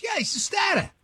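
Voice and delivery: exaggerated New England accent with dropped r's. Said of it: "I never heard an accent so insane".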